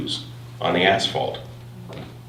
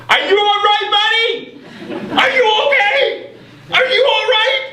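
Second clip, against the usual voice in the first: retold shout. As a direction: shout down through the visor. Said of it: "shouting through the visor of my helmet".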